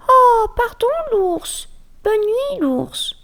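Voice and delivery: very high, tiny mouse voice; squeaky mouse goodnight. Acted. Mouse apologizing and saying goodnight to the bear, very high. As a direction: breathe out a tiny sorry. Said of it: "souffla la souris".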